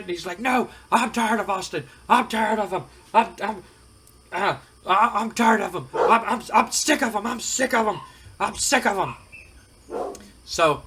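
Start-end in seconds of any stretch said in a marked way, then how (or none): 0.4-9.1 higher, sputtering, fed-up boss; a boss complaining to his son about the rebel; fed-up rant. Retold or imitated. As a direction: splutter it like you can't take any more. Mad and cussing up a storm.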